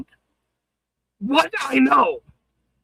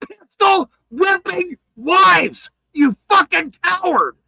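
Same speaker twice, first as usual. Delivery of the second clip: tearful furious shout; 6 semitones higher, slower than usual. Real, not acted. Streamer yelling at a wife-beater, near tears with rage. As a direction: drag each word out, choking with rage.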